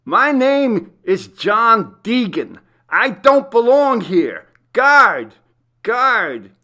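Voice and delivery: high, loud pleading shout; desperate calling out. A narrator's reading. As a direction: yell for the guard down the corridor. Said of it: "he called out".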